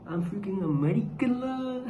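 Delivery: playfully exaggerated, high and slow; mock boasting. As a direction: stretch it out as a joking boast. Jokes about sounding American.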